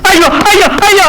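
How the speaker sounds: high yelps of pain, ouch ouch; crying out in pain. Acted. Wounded man yelping while his wound is dressed. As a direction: wince and cry out at each touch.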